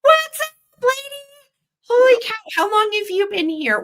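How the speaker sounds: very high, loud gushing greeting; excited hello. Real, not acted. Host welcoming a regular back to chat, thrilled.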